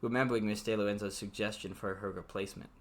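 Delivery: even novel reading voice; story narration. A narrator's reading. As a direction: read the book aloud, steady. Reads novel aloud.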